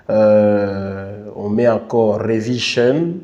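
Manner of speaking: ordinary talk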